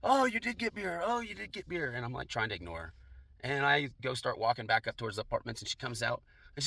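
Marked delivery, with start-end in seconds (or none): 0.0-1.9 very high mock holler, no real volume; retold yelling. Neighbor yelling off a balcony, high mock yell. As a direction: imitate a woman calling down, pitched way up. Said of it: "I hear her yelling off one of the balconies".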